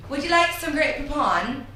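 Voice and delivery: high, put-on British accent; hammed-up British, pitched well up; best British accent. Shown as a demonstration. Host shows off for the guest, mock British accent. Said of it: "your best British accent now".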